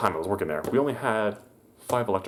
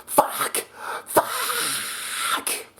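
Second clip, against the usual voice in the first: mock scream. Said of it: "and screams".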